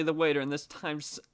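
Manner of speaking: ordinary talk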